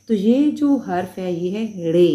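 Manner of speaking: रे said clearly as re, never अड़े; correct pronunciation. Shown as a demonstration. Teacher correcting students: रे, not अड़े. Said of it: "अड़े नहीं है".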